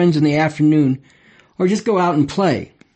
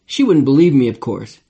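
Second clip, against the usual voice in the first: plain reading voice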